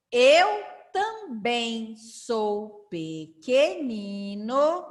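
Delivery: syllable by syllable, very slow and drawn out; slow model reading. Shown as a demonstration. Teacher leading children through a short rhyme, slow syllable-by-syllable reading. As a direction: read it out slowly for beginners, every syllable stretched.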